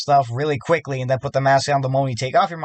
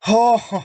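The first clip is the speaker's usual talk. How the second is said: ominous chuckle; 9 semitones higher, 4 dB louder than usual. Real, not acted. Ominous lead-in to hard night.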